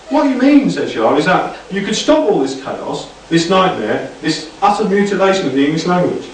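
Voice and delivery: a hint of a prim exasperated friend; lightly voiced Shah. A narrator's reading. Shah's character voice.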